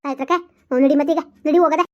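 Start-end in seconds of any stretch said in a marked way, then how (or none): none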